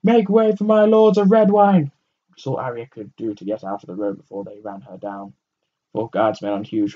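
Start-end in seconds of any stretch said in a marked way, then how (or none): 0.0-1.9 very high, loud call of "Make way" for the lords; herald's shout. A narrator's reading. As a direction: announce the lords, clearing the street. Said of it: "someone shouted".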